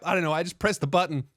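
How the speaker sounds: usual speaking voice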